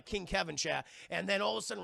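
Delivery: ordinary delivery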